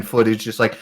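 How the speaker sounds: ordinary talk, nothing marked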